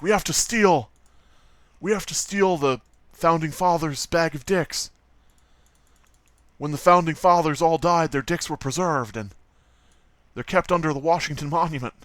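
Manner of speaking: mock-dramatic treasure-hunt pitch, slightly theatrical; Nicolas Cage. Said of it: "National Treasure movies with, uh, Nicolas Cage".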